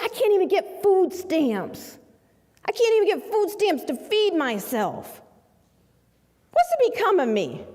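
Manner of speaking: high, strained despair; a man at the end of his rope; desperate client. Retold or imitated. Client pleading to lawyer, high and desperate.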